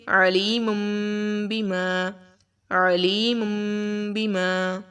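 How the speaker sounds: عَلِيمٌ بِمَا with the ending ن said as a nasal م; careful Quranic reading of the ن as م; iqlab recitation. Shown as a demonstration. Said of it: "عَلِيمٌ نہیں پڑھیں گے".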